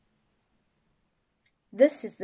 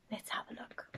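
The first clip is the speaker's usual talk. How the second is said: hushed whisper; 15 dB quieter, faster than usual. Acted. Storyteller whispering to young listeners, secretive. Teacher builds suspense for children.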